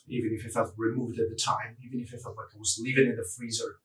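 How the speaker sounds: soft, quick muttered aside; quiet. Acted. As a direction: murmur it under your breath, fast. Muttered aside, much softer.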